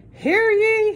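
"Hear ye" called out high and loud like a herald; town crier. Acted. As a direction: proclaim "Hear ye" to the whole street. Page announcing to the town.

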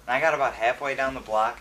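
normal talking voice

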